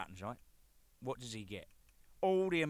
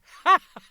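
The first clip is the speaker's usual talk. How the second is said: big laugh at his own joke; loud burst of laughter; 19 dB louder than usual. Real, not acted. Laughing loudly at his own redhead joke. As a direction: crack up loudly at the pun.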